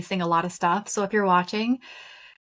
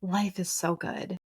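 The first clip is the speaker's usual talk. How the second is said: awed; slower than usual. Retold or imitated. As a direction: hush it like a revelation. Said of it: "it was just this, like".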